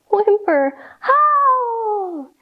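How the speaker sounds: high crying animal whimper into a howl. Acted. Night noises for the kids, high howling cry. Listing noisy night sounds.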